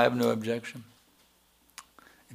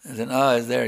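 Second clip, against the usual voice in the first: ordinary delivery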